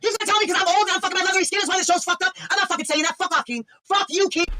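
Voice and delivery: high-pitched furious rant at Keith; angry rant. Pretend. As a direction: throw a hammed-up tantrum and refuse. Tantrum against Keith over the apology.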